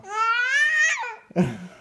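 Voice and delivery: giggle. Real, not acted. Giggle during play.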